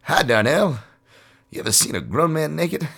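high mock impression of a TV character; Donnell character voice. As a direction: play the eager kid introducing himself. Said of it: "He's like".

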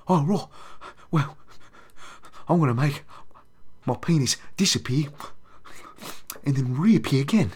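a put-on Cockney Michael Caine voice; Michael Caine impression. Doing a creepy Michael Caine magician for the viewers. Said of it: "I'm working on my Michael Caine".

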